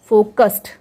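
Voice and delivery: फोकस्ड ending in a crisp "st"; final D as T. Shown as a demonstration. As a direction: close फोकस्ड with a t, not d. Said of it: "डी का sound ट होगा". (फोकस्ड के अंत में ट की आवाज़)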